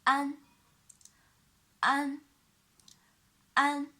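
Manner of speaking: "an" pronounced for students to copy; clear demo syllable. Shown as a demonstration. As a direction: model "an" with the tongue tip up. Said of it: "a with an alveolar nasal".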